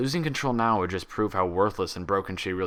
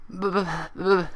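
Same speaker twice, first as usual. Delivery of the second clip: high teary stammer; on the verge of tears; blubbering; 11 semitones higher, 3 dB louder than usual. Acted. Pinkie trying to answer Applejack, blubbering. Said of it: "Pinkie blubbered".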